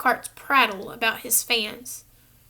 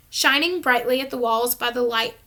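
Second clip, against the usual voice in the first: usual speaking voice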